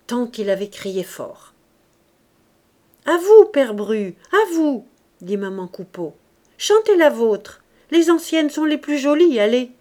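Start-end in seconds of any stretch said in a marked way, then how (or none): 3.1-4.9 loud, higher, eager urging; loud coaxing. A narrator's reading. Hostess urges old man to sing. 6.6-9.7 loud, higher, eager urging; loud coaxing. A narrator's reading. Hostess urges old man to sing.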